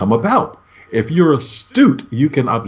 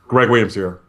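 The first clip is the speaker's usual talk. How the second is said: bad mood; 3 semitones lower than usual. Shown as a demonstration. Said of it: "giving you the impression that I'm in not the best of moods".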